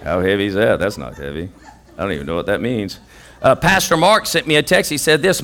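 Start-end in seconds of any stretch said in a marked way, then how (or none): 0.0-3.0 low, quiet scoffing voice; deep, soft laughing scoff; mock dismissive self. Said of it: "And I'm like".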